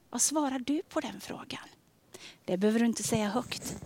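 ordinary delivery

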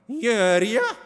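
high, loud mocking echo of the angel; mock him with a hiss; hissing mockery. A narrator's reading. Kain mimics the angel, hissing. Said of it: "väsande härma honom".

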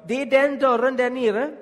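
loud high shout from the window. Retold or imitated. Man shouts from his window to police arriving, loud and high. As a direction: call out to the police below, loud. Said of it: "ropade jag".

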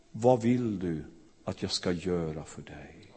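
low, soft, tender asking; gentle question. Acted. Jesus gently asking the beggar what he wants, low and soft. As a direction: ask kindly, drop to near a hush.

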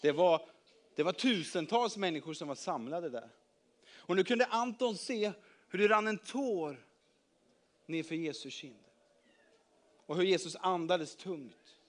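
ordinary delivery

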